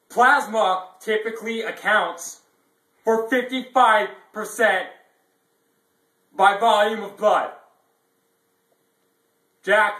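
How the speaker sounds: loud, high ranting lecture; yelling. Acted. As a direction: yell the facts at him. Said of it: "there is no reason to be yelling".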